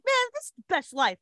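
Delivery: high-pitched happy gushing; blissfully excited. Retold or imitated. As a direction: re-enact being thrilled with life. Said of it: "It was, like, the best thing ever".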